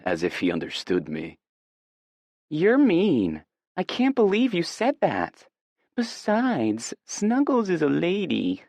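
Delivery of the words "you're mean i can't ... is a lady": put-on high-pitched baby voice; baby talk. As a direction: coo at her like a baby. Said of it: "She baby talks".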